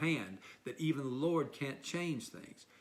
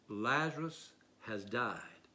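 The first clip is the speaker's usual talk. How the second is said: solemn; 2 semitones lower, slower than usual. Acted. Jesus states it plainly.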